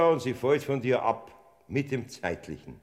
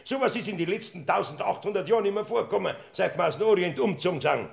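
agitated rush; 7 semitones higher, faster than usual. Acted. Portner rattles on agitated.

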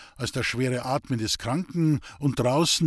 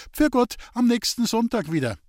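higher, brightly raised voice for others to hear; loud goodbye; 10 semitones higher, 4 dB louder than usual. A narrator's reading. Girl makes sure mother hears, loud innocent goodbye. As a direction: call a goodbye meant to be overheard. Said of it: "so laut, dass es die Mutter hören konnte".

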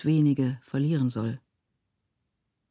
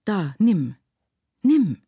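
loud, forceful command, climbing on the repeat; vehement; 4 semitones higher, 7 dB louder, slower than usual. A narrator's reading. Mother pressing food on the child, vehemently. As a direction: thrust the words out sharply. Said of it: "fügte sie heftig hinzu".

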